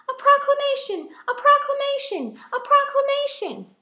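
high, loud repeated cry; excited panic. Acted. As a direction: shout it, flustered and thrilled.

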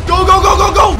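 rally the attack at full voice; hype shout. Real, not acted. Dragon almost beaten.

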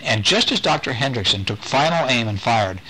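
usual speaking voice

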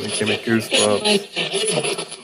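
loud, stretched-out astonishment; really blown away, voice up and loud; amazed. Real, not acted. Ghost hunter stunned by a loud spirit answer, amazed.

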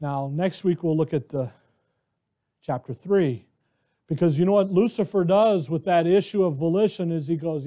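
ordinary talk, nothing marked

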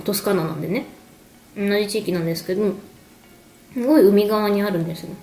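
normal talking voice

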